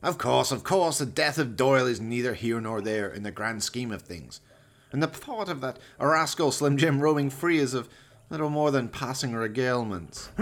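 a villain dismissing his dead henchman; Valdemar, cold villain. Acted. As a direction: savour each cruel word. Valdemar voiced in the story.

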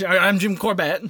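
put-on light Canadian accent, laughing. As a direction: say it with a hint of Canadian. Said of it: "I gave him a Canadian accent".

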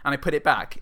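ordinary talk

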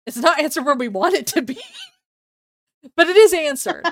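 loud, high laughing protest; loud laughing complaint; laughing shout. Real, not acted. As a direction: laugh it out loudly. Laughing shout about the show.

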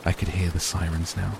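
plain reading voice

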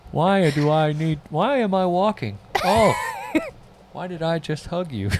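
high-pitched, baffled self-questioning; mock bewilderment. Host drawn to someone for some reason, mock bewilderment. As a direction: act helplessly drawn over, baffled by yourself. Acts out helpless attraction to someone.

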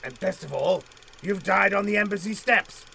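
normal talking voice

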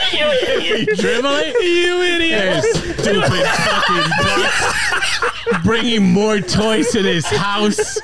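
high, slow, laughing mock tirade; laughing yell. Real, not acted.

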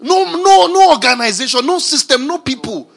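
shouted sermon defiance; defiant preaching. Acted. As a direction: throw it at them in defiance.

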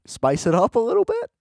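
begging the filmmakers for variety in a squeaky high voice; mock plea. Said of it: "It's like".

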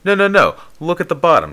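high, loud retold insistence; insistent correction. Friend's retold urgent correction.

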